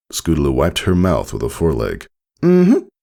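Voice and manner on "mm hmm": filly voice. A narrator's reading. Filly answering, mouth just wiped.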